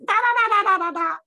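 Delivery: high, loud rapid da-da chatter; very high pitch. Retold or imitated. Imitating the voice in her head from iboga, very high pitch. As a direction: squeal out a string of nonsense syllables. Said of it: "very high pitch".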